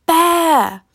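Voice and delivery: high, long call into the distance; drawn-out high holler; yelled. A narrator's reading. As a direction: yell it to someone far away. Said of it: "Dusan yelled to the distant Rolando".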